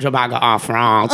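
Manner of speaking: a hammed-up French accent, drawn out; terrible French accent. Friend redoes his own joke in a terrible French accent. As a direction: lean into a bad French accent. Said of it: "I was doing a really terrible French accent".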